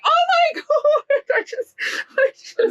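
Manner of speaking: high, slow, tearful break; crying. Real, not acted. Mother breaks down in tears on seeing her kids in the corridor. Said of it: "so obviously I'm emotional".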